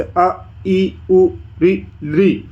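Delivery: "अ, ई, उ, री, री" as short, separate vowel sounds; teacher demonstrating short Sanskrit vowels; short one-mora vowels. Shown as a demonstration. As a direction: recite each short vowel on its own, one beat apiece. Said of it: "जिनके उच्चारण में एक मात्रा का समय".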